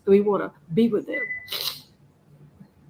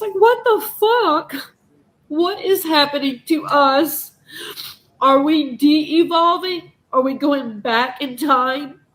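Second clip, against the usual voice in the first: slow, loud and high, appalled; outraged disbelief; 3 semitones higher, 4 dB louder, slower than usual. Real, not acted. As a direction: demand an answer, louder and higher. Outraged rant at cruelty.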